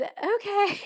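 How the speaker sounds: high, spooked okay; a rattled, nervous okay to the wall art. Retold or imitated. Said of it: "super spooked".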